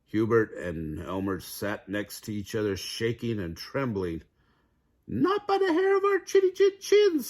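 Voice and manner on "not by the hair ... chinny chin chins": very high piggy squeal; squealing pigs. A narrator's reading. Two pigs refusing the wolf in a high squeal. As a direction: squeal it like terrified piglets. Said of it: "squealed the pigs".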